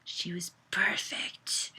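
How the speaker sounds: slow, hushed near-whisper; said to himself. A narrator's reading. Man talking to himself after Mariana leaves, hushed. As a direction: murmur it privately, drawing it out. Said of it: "he said to himself".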